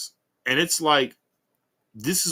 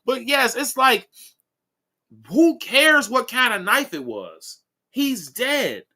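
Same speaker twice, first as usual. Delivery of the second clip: ordinary talk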